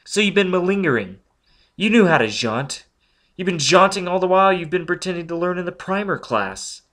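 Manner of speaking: outraged accusation; accusing. Acted. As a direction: pin him with each charge. Robin accuses Foyle.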